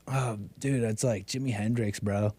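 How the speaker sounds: quiet, low drawling stoner. Retold or imitated. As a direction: mumble it like a burnt-out hippie. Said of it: "you guys think you're like".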